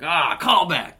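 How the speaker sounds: comic retelling to interviewer of calling out "callback" to open-mic audience, mock shout. Shout it out as a self-mocking stage announcement. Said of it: "I just said the word".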